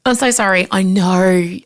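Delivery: normal talking voice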